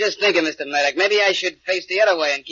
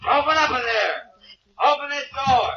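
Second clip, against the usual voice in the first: shouting through door; 9 semitones higher than usual. Acted. Police pounding at apartment door.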